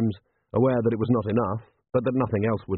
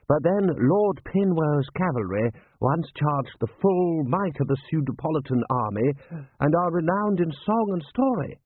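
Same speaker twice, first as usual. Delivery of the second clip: higher, swelling, drawn-out patriotic boasting; proudly invoke military glory; pompous general; 7 semitones higher than usual. A narrator's reading. Rust figure in narrated story.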